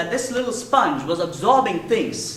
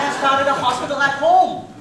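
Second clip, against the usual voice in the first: put-on high, louder little-girl doctor voice; child doctor; 9 semitones higher, 9 dB louder than usual. Daughter playing doctor telling her mother, high and loud. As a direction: announce it proudly as a little doctor.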